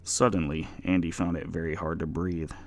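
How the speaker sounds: plain reading voice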